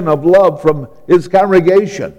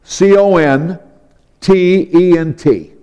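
ordinary delivery

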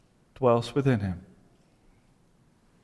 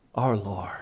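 soft whispered close; 8 semitones lower, 11 dB quieter than usual. Acted. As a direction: breathe the last words.

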